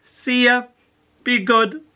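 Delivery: high, cheery mum waving them off; mother's send-off. Retold or imitated. Mother sending the poem off to school, high and cheery. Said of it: "all the things my mother would say".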